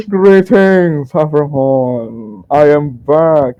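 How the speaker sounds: high, loud, drawn-out showman greeting; grand merchant greeting. Acted. Merchant announces his return to the orcs, grand theatrical greeting. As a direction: hail everyone slowly and theatrically, falling from high.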